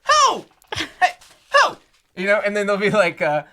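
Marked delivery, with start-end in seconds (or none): 0.1-1.8 very high, loud hoot; Chris Tucker owl. Retold or imitated. Showing a friend an owl trend, high comic hoots. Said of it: "an owl if they were Chris Tucker".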